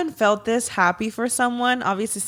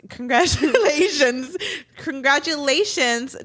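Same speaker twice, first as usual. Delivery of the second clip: drawn-out congratulations; 5 semitones higher, slower than usual. Real, not acted. Repeated congratulations, stretched.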